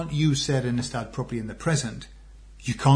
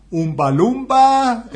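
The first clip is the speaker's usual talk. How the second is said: high, loud, slow sing-out in Geordie; Geordie accent; 10 semitones higher, 7 dB louder, slower than usual. Retold or imitated. Showing the words that teach you Geordie, Geordie accent. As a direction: stretch "Oompa Loompa" into exaggerated Geordie. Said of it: "he was saying, to learn Geordie".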